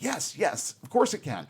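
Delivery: acted gush of agreement; exuberant. Sybok eagerly assuring God the starship can, exuberant. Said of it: "is very exuberant here".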